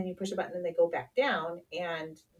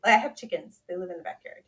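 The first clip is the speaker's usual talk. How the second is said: ordinary talk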